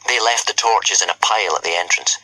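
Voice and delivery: ordinary delivery, nothing marked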